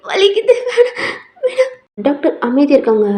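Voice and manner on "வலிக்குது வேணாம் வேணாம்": high, tearful yelp; acted sick-girl pain, breaking into sobs; crying in pain. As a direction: beg the doctor to stop pressing. Patient cries as stomach is pressed.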